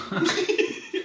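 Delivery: high, soft giggle; squeaky quiet laugh. Real, not acted. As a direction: let out a high little giggle. Laughing at missing bowls joke.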